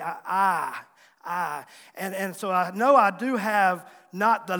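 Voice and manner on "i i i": "I" repeated as a drawn-out "ah"; Southern "ah" vowel. Shown as a demonstration. Preacher demonstrates his accent, "I" flattened to "ah". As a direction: try out "I" as a flattened country "ah". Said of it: "I still hear myself sometimes".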